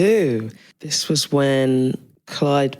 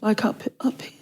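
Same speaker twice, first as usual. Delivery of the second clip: whisper; 4 semitones higher, 6 dB quieter than usual. Pretend. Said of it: "go up a few decibels".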